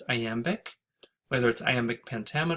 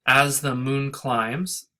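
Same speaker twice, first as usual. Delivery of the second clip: "As the moon climbs" slow and a little louder; slow scansion; 4 dB louder, slower than usual. Shown as a demonstration. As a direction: read it slowly, marking the beats. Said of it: "this is, um, this is, again, irregular".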